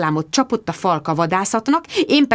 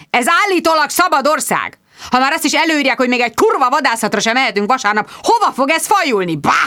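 bang the table and rant; angry rant; 6 semitones higher, faster than usual. Acted. Squire rages at reporter about hunting ban, furious and high. Sir Hugo ranting, bangs table.

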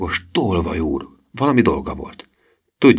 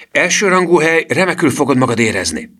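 excited boast; 9 semitones higher, 5 dB louder than usual. Acted. Gusztáv boasting of hotel.

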